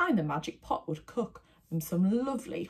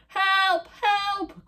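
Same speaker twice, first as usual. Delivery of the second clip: high loud panicked calls; crying for help; 14 semitones higher, 5 dB louder than usual. A narrator's reading. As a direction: townspeople shouting for help. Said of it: "cried the townspeople".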